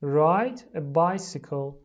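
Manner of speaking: very slow, loud teacher model; slow model phrase. Shown as a demonstration. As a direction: drag out each word for repeating. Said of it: "لطفاً تکرار کنید".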